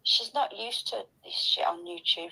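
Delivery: ordinary talk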